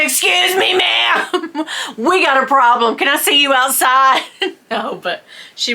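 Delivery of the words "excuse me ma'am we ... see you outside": high, hammed-up indignant challenge; mock confrontation. Wife jokingly confronting the clerk, mock indignant. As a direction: call her out in jest, laughing midway.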